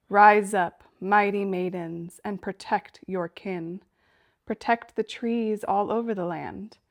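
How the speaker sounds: loud, measured incantation with ringing line openings; ritual invocation. Acted. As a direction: summon protectors in a chanted, declamatory prayer. Recites an invocation to nymphs.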